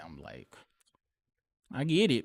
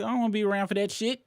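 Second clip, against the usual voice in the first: heated outburst; 12 semitones higher, 8 dB louder, much faster than usual. Real, not acted.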